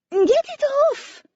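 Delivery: put-on high, loud, drawn-out French-accented scream; panicked French woman screaming. A narrator's reading. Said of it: "she screamed in a pretty French accent".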